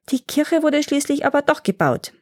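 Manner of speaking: a hint of a small child objecting; little grandson correcting grandfather, a lightly voiced child; small boy's voice. A narrator's reading. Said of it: "entgegnete der kleine Tjovik".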